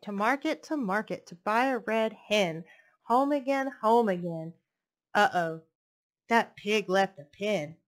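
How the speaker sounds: put-on lilting read-aloud voice for little kids; storybook reading. Reading a picture book to young children, lilting storytelling. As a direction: read it like bedtime story time.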